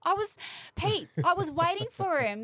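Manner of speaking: loud, high mock call for the missing Pete. To the late co-host Pete, a loud mock call. Teasing absent Pete on the podcast.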